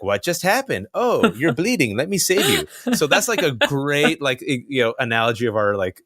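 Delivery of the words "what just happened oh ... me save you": a hammed-up gentle rescuer; imitating caring wife. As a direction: make the wife sound earnestly kind. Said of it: "Sarah's like".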